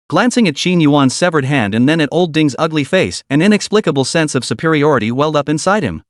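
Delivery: usual speaking voice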